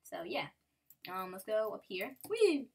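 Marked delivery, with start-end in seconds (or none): 2.3-2.7 high, loud whoop of joy; gleeful whee. Real, not acted. Player leaping up in the game with a high gleeful whee. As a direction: let out a happy whee on the jump.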